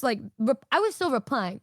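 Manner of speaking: ordinary talk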